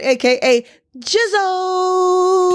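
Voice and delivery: high, slow stretched hype call; hype name drop. Acted.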